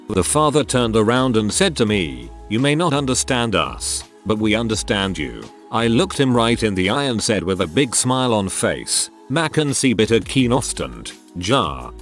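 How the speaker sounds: plain reading voice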